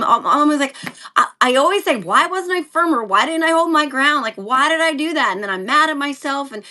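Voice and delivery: ordinary delivery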